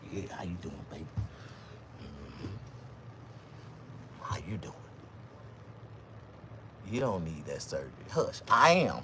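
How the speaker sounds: low, soft crooning voice; smooth talker. Retold or imitated. Tall him greeting women after surgery. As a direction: croon it like a ladies' man.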